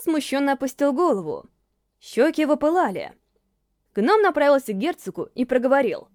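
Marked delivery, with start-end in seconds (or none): none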